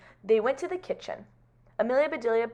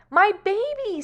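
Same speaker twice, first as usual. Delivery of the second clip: high, louder cry of dismay; alarmed mother; 11 semitones higher, 6 dB louder, slower than usual. Acted. Missus Lane seeing her messy baby, alarmed. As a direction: exclaim like a horrified mom.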